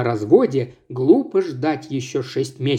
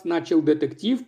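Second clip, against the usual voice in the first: usual speaking voice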